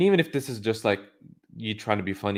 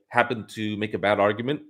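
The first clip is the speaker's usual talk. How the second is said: ordinary talk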